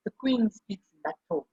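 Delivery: slow, very formal Queen's English; stately Queen's English, said slowly; Queen's English impression. Shown as a demonstration. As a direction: draw it out the way the Queen would. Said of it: "এইভাবে basically কুইন্স ইংলিশে কথা বলা হয়".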